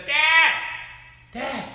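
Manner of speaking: high, loud shout for Dad; desperate cry for Dad. Acted. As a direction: call out loudly, stuck and scared. Scared girl cries out for help.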